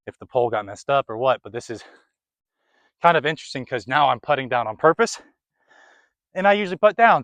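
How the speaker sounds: normal talking voice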